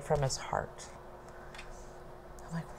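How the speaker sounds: usual speaking voice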